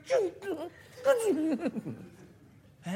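very high, tiny hesitant call; timid young woman. Acted. Young woman calling from the toilet, hesitant and small-voiced. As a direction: call out shyly in a little squeak. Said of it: "気兼ねしながら小さな声".

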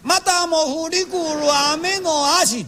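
the voice jumps up out of nerves; nervous falsetto joruri line; voice cracking high. Retold or imitated. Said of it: "こんなとこから声が出てしもうてな".

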